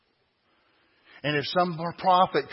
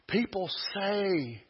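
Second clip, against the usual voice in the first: ordinary talk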